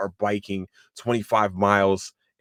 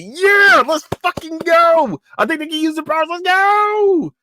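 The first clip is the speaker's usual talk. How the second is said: full-throated high cheering yell; hyped; 8 dB louder than usual. Retold or imitated. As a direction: holler like a fan going wild, drawing out the last word. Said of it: "I was hyped to see them".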